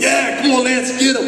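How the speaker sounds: high cheering fan voice. Retold or imitated. Fans on their feet cheering for Lance, excited. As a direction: yell it like a fan in the stands.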